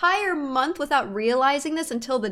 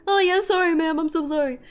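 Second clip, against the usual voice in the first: put-on, pitched-up customer-service apology; mock apologetic dealer; 3 semitones higher, faster than usual. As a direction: mimic a flustered dealer's repeated apology. Mimics dealer apologizing to her.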